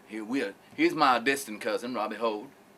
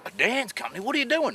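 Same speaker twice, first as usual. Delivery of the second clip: incredulous; 10 semitones higher, faster than usual. Retold or imitated. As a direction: pitch leaping up in disbelief. Boss retells his disbelief.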